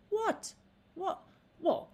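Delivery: spring up wide open and unbothered; open-eyed what. Shown as a demonstration. Actress demoing director's open-eyed Eliza reaction, bright. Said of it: "open your eyes and go".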